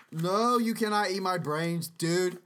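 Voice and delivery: ordinary talk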